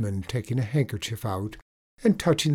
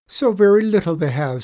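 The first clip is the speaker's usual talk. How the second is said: lightly voiced leprechaun, higher and louder; leprechaun telling a pitiful tale; 8 semitones higher, 8 dB louder than usual. A narrator's reading. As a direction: tell a woeful tale as the leprechaun, raised voice. Leprechaun tells sad tale.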